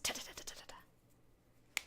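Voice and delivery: soft whispered ta ta ta; whispering. Real, not acted. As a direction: whisper the clicks under your breath. Clicking fast, whispering to self.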